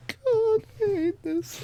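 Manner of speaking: high, whimpering groan of embarrassment; agonized whine. Real, not acted. Guest dreading the confession, agonized whine.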